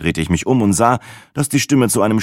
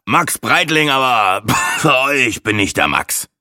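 high-starting boast broken by coughing; coughing show-off; 6 semitones higher than usual. A narrator's reading. Show-off introducing himself to the travellers, coughing. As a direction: introduce yourself grandly, coughing mid-sentence. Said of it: "hustete der Angeber".

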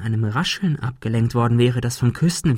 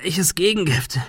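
higher, strained question; in pain; 5 semitones higher than usual. A narrator's reading. Thomas shot in the shoulder questioning Adrian, in pain. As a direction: ask through the pain. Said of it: "fragte er unter Schmerzen".

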